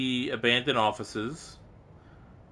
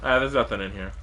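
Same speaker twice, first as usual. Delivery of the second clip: ordinary talk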